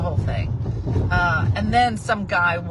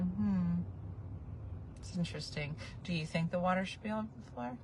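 dry sarcasm; 12 dB quieter, faster than usual. Retold or imitated. Sarcastic reply to roommate.

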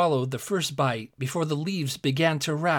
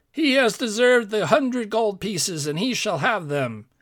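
raised, ringing royal pronouncement; proclaiming king; 7 semitones higher, 4 dB louder than usual. A narrator's reading. King rewarding the tailor before the court, proclaiming. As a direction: declare it to the whole court, higher and louder.

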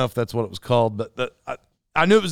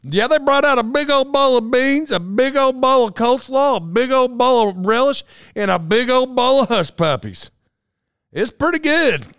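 high, hammed-up country wife; exaggerated high-pitched impression of wife's enthusiasm; imitating his wife; 9 semitones higher, 3 dB louder than usual. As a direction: chant the list in a raised, twangy mimicry of wife. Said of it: "That's how she sounded".

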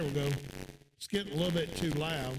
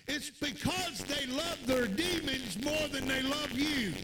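higher, fervent pulpit voice; preaching; 5 semitones higher than usual. Acted. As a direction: preach it with rising fire. Preaching at full force.

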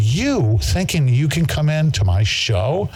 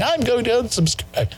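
mocking quote; 12 semitones higher than usual. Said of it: "by saying".

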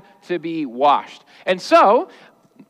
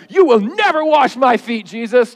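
emphatic protest; 5 semitones higher, 4 dB louder than usual. Acted. Peter's outburst replayed with emotion.

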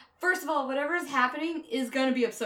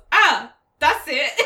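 slow, much louder hammed-up sigh of relief; mock satisfied sigh; 4 semitones higher, 13 dB louder, slower than usual. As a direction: drag out a cartoon sigh. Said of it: "all you needed was".

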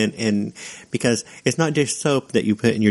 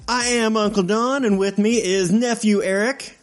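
fake radio host intro; 11 semitones higher than usual. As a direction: put on an upbeat host voice. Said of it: "let me fake one".

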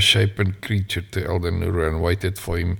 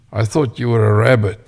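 teasing; 4 semitones higher, 6 dB louder, faster than usual. Acted. Elder teasing the child.

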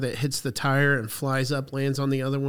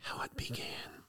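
eerie spooky whisper; 16 dB quieter than usual. Pretend. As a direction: breathe it like a ghost story. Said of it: "dramatic whispered echo".

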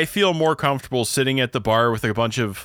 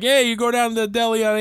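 New York guy; 11 semitones higher than usual. Retold or imitated. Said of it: "They were talking about, like".

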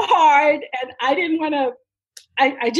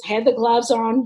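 normal talking voice

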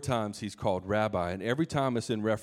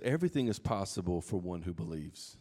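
quiet promise; 5 semitones lower, 6 dB quieter than usual. Retold or imitated. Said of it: "Jesus says".

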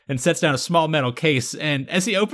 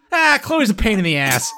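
full-intonation exasperated quote; 5 semitones higher than usual. Retold or imitated. Quoting Ryan's relatable moment with full intonation, exasperated. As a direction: act out the exasperated complaint, starting high and falling. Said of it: "quote with full intonation".

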